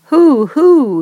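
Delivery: high, loud hooting call; owl call. A narrator's reading. Owl calling to the unseen flapping sound. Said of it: "he called".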